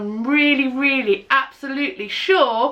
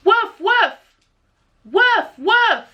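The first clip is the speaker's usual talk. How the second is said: high, louder playful woofs; dog barks; 10 semitones higher, 3 dB louder, slower than usual. Shown as a demonstration. Teacher shows the children how a dog barks, playful woofs. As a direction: bark like a friendly dog. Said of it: "a dog goes".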